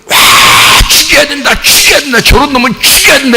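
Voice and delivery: high, roaring crowd cry; mob clamour. Acted. Plotters clamour for Jesus to be killed, high mock-mob shout. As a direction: chant it like a frenzied mob out to kill. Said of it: "죽이려고 막 모의해서요".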